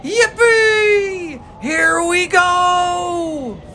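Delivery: soaring cry of pure joy; shouted yippee. A narrator's reading. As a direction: whoop it out like a kid on a ride. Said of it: "shouted Grandpa Joe again".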